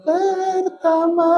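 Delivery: women's group voices up high; shrill high call. Real, not acted. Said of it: "ibu-ibu wayahna teriak". (suara ibu-ibu melengking tinggi)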